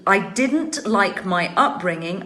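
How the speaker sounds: ordinary talk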